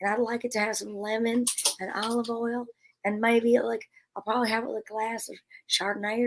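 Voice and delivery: ordering a salad in a lightly done Texas voice; wife playing Holly Hunter ordering at a restaurant; Holly Hunter impression. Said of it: "You need to do Holly Hunter character".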